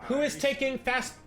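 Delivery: ordinary talk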